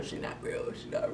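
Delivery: breathy whispered aside; muttered whisper to herself. Real, not acted. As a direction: drop to a whisper in disbelief. Repeats, then whispers it.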